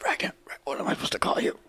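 fast, breathy unvoiced threat; menacing whisper. Interrogating captive, whispering menacingly.

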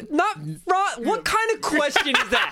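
high, incredulous laughing protest; mock outrage. Game master scoffs at Ragna being compared, mock outrage. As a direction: protest as if scandalised, half laughing.

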